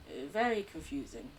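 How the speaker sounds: normal talking voice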